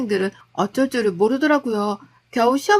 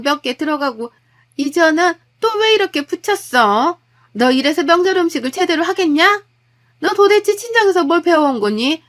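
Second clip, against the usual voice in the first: loud, nagging mother-in-law tearing into her; angry scolding; 4 semitones higher, 4 dB louder than usual. Acted. Mother-in-law berating her daughter-in-law over the birthday food, angry scolding. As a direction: scold every dish, voice rising high and loud. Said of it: "하나하나 타박을 하시더라고요".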